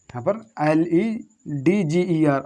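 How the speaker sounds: ordinary talk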